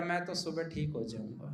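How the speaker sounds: put-on low, soft, sly drunk reply; drunk's quiet reply. Drunk husband tells his wife, low and soft. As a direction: mutter it like a tipsy man.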